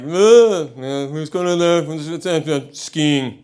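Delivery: mock English chatter, slow and drawn out; middle-class English neighbours. English neighbours making small talk, mocking impression. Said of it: "so they say shit like".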